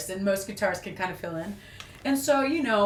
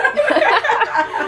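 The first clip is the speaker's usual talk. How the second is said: loud laugh; 10 dB louder than usual. Real, not acted. Big laugh.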